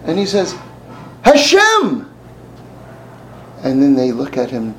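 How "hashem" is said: high, loud exclamation; cried out. Retold or imitated. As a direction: burst out with the name. Rabbi cries God's name.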